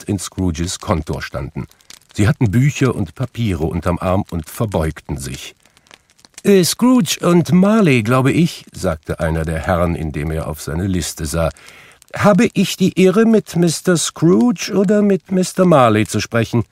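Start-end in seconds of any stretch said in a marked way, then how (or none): 6.4-16.6 a stout gentleman asking with formal politeness; polite gentleman. A narrator's reading. Visiting gentleman addressing Scrooge, polite formal inquiry.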